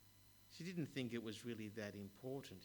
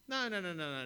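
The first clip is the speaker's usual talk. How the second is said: loud, high burst of no; emphatic no; 7 semitones higher, 10 dB louder than usual. Acted. The mayor cutting off a boy's answer, loud and emphatic. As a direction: cut the boy off sharply.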